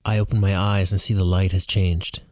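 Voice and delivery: usual speaking voice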